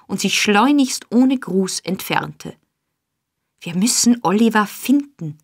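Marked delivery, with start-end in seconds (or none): none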